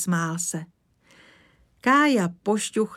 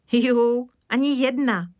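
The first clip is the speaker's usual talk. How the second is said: put-on higher, louder, merry boy's exclamation; gleeful child; 6 semitones higher, 6 dB louder than usual. A narrator's reading. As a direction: lightly exclaim it with childish glee. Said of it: "smál se Kája". (rozjařeně, klučičím hlasem)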